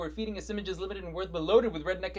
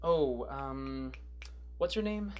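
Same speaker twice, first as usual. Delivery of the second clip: low, hesitant filler; feigned forgetting; 5 semitones lower, 3 dB quieter, much slower than usual. Pretend. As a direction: stall as if the name escapes you. Pretending to forget her name.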